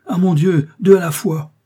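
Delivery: high, loud panicked outcry; overwhelmed, two patients at once; flustered alarm. A narrator's reading. Charles panics as Justin faints too, high and loud. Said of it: "dans son émotion".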